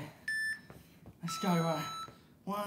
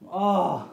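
loud effort groan; pained groan; 5 dB louder than usual. Real, not acted. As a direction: groan from burning shoulders. Shoulders burning.

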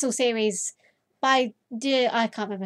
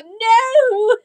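high, loud startled "no!"; sudden cry; 11 semitones higher, 9 dB louder than usual. Real, not acted. Player suddenly crying out in dismay while reading. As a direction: yelp it as if caught off guard. Sudden outburst mid-sentence.

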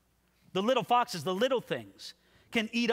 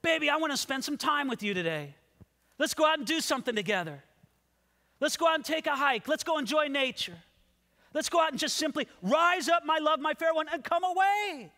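higher, lively, coaxing sweetheart; eager invitation; 9 semitones higher, faster than usual. Acted. Beloved asking the maiden out for the day, eager. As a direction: urge your love to come along, pitched up.

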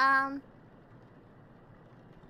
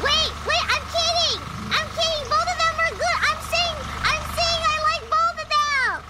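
higher, louder hurried take-back; urgent backpedal; 9 semitones higher, 5 dB louder than usual. Real, not acted. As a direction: rush to take it back, laughing it off. Backpedals after teasing the song.

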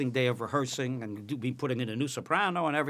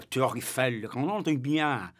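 fake French. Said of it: "Sid Caesar used to do all the fake French".

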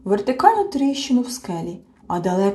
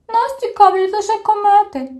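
small creature's voice; 13 semitones higher, 7 dB louder than usual. A narrator's reading. Tiny creature voice.

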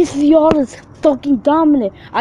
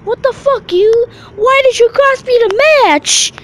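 outraged wrestler; 12 semitones higher, 5 dB louder than usual. Retold or imitated. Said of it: "they're like".

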